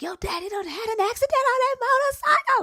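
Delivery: high, frantic mock scream of the news; mother screaming the bad news down the phone; retold scream. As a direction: cry out the news in a panic. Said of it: "Like, she's screaming".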